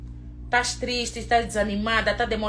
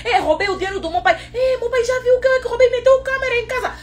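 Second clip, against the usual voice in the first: put-on higher, startled voice of a child caught; lightly voiced panicked child; guilty child; 13 semitones higher than usual. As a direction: play the scared kid who stole. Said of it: "Você fica com medo".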